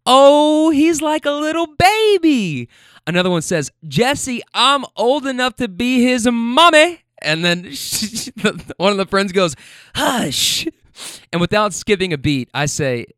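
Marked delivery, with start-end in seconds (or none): 0.1-2.7 loud, high, drawn-out mock coo; hammed-up squeal over someone adorable; gushing cooing. Tipsy rider fussing over young driver, high mock coo. 3.9-7.0 high, slow, loud imitation of a woman; hammed-up protest stretched out high; mock scandalized. Rider protesting to her friend, mock scandalized. 10.0-10.7 high, hammed-up shush; mock shushing. Rider silencing her friend, mock shush.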